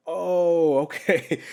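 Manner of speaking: loud, high-pitched mock alarm; startled reaction. Guest reacting to a cat that quacks, loud mock alarm. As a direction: jump up in pitch and volume, playing startled.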